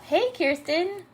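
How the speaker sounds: high, slow singsong hello; singsong greeting. Real, not acted. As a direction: sing the greeting out.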